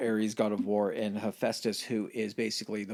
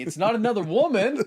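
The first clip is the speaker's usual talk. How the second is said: much higher, louder protest; mock protest; 11 semitones higher, 7 dB louder than usual. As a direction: insist too hard. Zeus defending himself to Hera.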